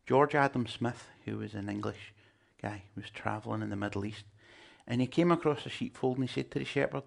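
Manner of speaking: ordinary delivery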